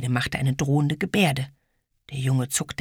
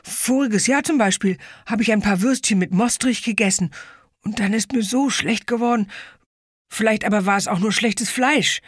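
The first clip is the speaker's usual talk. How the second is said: nervous confession; 8 semitones higher, 4 dB louder than usual. Acted. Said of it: "gestand er schnell, vor Angst".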